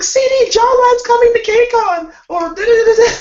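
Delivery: very high, loud squeal; high-pitched loud fan squeal. Retold or imitated. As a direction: shout it like a giddy fan. Quoting fans' excited messages.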